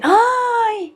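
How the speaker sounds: high startled exclamation; sudden realization. Retold or imitated. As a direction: catch the sudden penny-drop. Sudden realization, then laughs. (exclamación aguda de sorpresa)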